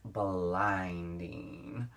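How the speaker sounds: BLINDING stretched out long and slow; "blinding" slowly savored, syllable by syllable; drawn-out emphasis. Real, not acted. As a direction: drag out the word blinding for effect. Playful exaggeration of highlight strength.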